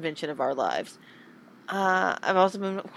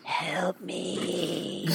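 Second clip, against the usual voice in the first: hushed, much softer ghostly moan; mock spooky; 7 dB quieter than usual. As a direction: breathe it like a faint ghost. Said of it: "You sound spooky as hell".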